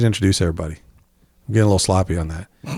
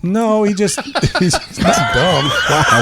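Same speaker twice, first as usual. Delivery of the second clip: laughing; 10 semitones higher, slower than usual. Real, not acted. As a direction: crack up laughing. Laughing at joke.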